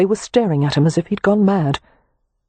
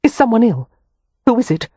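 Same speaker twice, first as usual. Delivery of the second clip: alarmed; 7 semitones higher, 4 dB louder than usual. A narrator's reading. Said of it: "face draining of colour".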